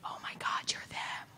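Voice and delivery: whispered, awed, trailing slow; hushed like meeting a hero; starstruck whisper. Retold or imitated. Fan meeting a favourite creator, awed whisper.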